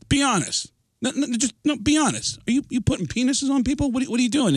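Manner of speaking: high, halting, pleading questions; earnest confronting. Retold or imitated. Tattoo shop sitting the artist down, earnest confronting.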